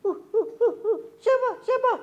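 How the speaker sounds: squeaky high puppet asking eagerly. Acted. Puppet Kobon begs the host to tell who's coming, eager. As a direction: bounce with curiosity.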